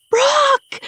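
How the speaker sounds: high, loud desperate scream; frantic full-voiced shout. A narrator's reading. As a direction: scream the name out in panic. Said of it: "I scream".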